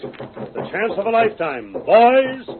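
high, loud, gloating; gleeful triumph. Acted. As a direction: savor the prize out loud. Boss gloats over capturing Silver.

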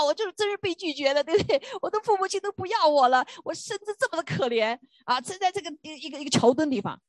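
high-pitched, anguished complaint, near tears; crying out. Retold or imitated. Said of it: "就哭啊".

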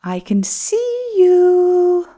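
snake calling out. Acted. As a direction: call it out like a menacing snake. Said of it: "called the snake".

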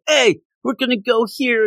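high-pitched mock eager kid; imitated naive kid. Young driver to the mobster, imitated eager kid. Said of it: "Richie's kinda like this duplicate kid".

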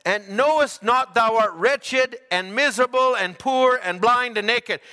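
slow, emphatic verse reading; scripture reading. Acted. Preacher reading Proverbs to the congregation, slow and solemn. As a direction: intone each phrase slowly.

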